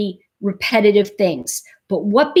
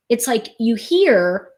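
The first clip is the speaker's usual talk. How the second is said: normal talking voice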